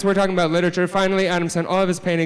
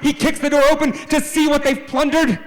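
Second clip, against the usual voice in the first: high, loud storyteller's build-up; climactic shout; 9 semitones higher, 5 dB louder than usual. Acted. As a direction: shout it as the big dramatic reveal. Climax of the pirate raid.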